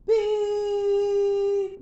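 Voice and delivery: long high loud zap beep; high sustained zap sound; imitated blast beep. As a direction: make the laser-blast noise, held.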